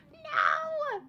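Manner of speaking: very high wail of dismay; dismayed cry. Real, not acted. Streamer losing her train of thought, high dismayed cry.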